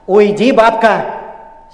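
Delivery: slow, loud, hateful order; hissing with hatred, loud and drawn out. Acted. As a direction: drive the old woman away with loathing. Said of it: "с ненавистью сказал я ей".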